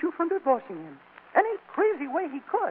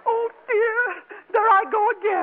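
starting to cry; 6 semitones higher than usual. Acted. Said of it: "She's crying".

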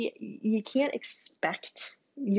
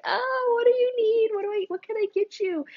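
put-on high, loud cooing to a crying baby; worried parent cooing over a crying baby; 3 dB louder than usual. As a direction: coo up high like comforting a newborn. Soothing crying newborn.

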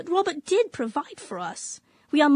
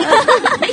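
put-on a loud, high burst of laughter at the old dress; 7 semitones higher, 9 dB louder than usual. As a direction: laugh out loud at her niece's frock. Mocking the hideous dress.

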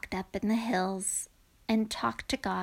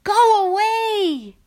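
long, high, loud holler; drawn-out high yell; frightened shout; 10 semitones higher, 10 dB louder, slower than usual. A narrator's reading. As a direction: shout it slowly to drive off a ghost. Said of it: "They shouted".

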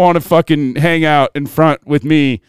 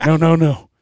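eager Santa voice; 3 semitones lower than usual. Retold or imitated. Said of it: "he goes".

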